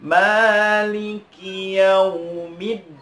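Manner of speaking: YAUMIDDIN chanted slowly, leaning firmly on the doubled d; pressed doubled consonant. Shown as a demonstration. Teacher models the verse for learners, firmly pressed double d. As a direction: recite "Maliki yaumiddin" with a held, pressed DD. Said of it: "Maka harus ditekan".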